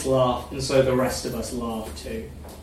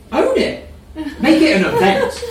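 high laughing delivery; 13 semitones higher, 5 dB louder than usual. A narrator's reading. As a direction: laugh through the words. Narrator voices character, laughing.

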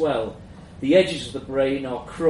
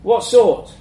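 colleague voice; 8 semitones higher, 10 dB louder than usual. A narrator's reading. Colleague presses the boss.

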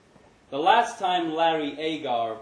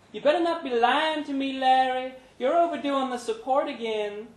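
much higher, louder nagging woman; scolding Brenda; 12 semitones higher, 6 dB louder than usual. A narrator's reading. Brenda scolding Larry down the phone, high and sharp.

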